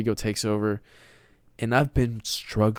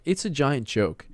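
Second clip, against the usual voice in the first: usual speaking voice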